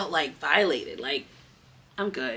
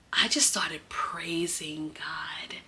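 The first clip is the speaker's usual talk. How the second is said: whispered; 3 semitones lower, 8 dB quieter, slower than usual. Real, not acted. Recalling relief at home.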